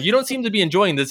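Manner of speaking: high, loud, fast puppet voice; quick squeaky puppet chorus; questioning sock puppets. Retold or imitated. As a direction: rattle it off as a puppet. Other puppets questioning him.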